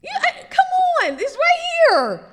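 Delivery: high, loud laughing cry; laughing exasperation. Acted. Preacher exasperated at lost believers, loud laughing cry.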